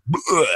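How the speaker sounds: mock gag; disgusted bleh. Real, not acted. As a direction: let out a revolted "bleh". Disgust at penny stock mentality.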